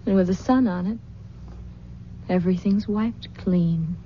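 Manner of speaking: usual speaking voice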